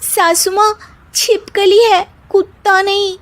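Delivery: high, sassy correction; daughter-in-law voice. Acted.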